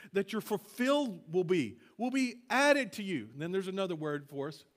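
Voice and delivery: normal talking voice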